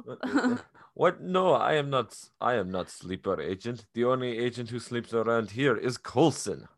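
flustered mock-Russian denial; hammed-up Russian spy protesting innocence; full Russian accent. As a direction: put on a thick Russian accent. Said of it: "he has the full Russian accent".

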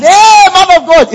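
"Hey, man of God" shouted high and loud; excited greeting. Retold or imitated. Recalling the pastor's phone call, an excited high shout. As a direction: greet him with a burst of joy. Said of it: "I was so excited".

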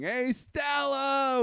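high, long-drawn imitation yell; mock movie cry, stretching the name out; Marlon Brando. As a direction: call up the stairs in a drawn-out wail. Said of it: "was Marlon Brando saying".

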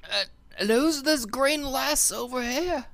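put-on high, loud cranky old hermit; grumpy old codger, barking questions; old prospector.

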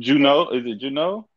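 loud New Orleans "Juno", high rising question; New Orleans accent. Shown as a demonstration. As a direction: run "you know" into New Orleans "Juno". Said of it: "one of my favorite accents".

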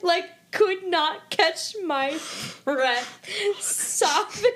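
laughing through words. Real, not acted. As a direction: squeeze the words out between laughs. Retelling sobbing over the show.

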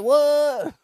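high, loud disbelieving what; shocked what. Retold or imitated. Reacting to people throwing God in, shocked. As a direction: blurt it out in disbelief. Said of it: "I'm like".

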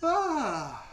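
loud, high yawning sigh; big yawn. Real, not acted. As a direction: let out a real yawn. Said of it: "sorry, I keep yawning".

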